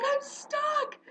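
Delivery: high, panicked cry for help; scared kid stuck. Retold or imitated. Little Tim crying out stuck in the marsh, panicked. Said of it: "he's like".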